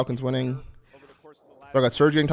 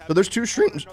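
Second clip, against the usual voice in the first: voice crack; 7 semitones higher than usual. Real, not acted. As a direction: voice cracks high on streams. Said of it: "voice crack".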